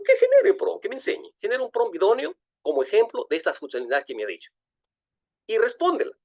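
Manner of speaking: high, loud, eager orders to the machine; excited command. Retold or imitated. Lecturer commanding the chatbot to write a prompt, excited and high. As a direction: fire off the request like an eager boss. Acts out his command to ChatGPT.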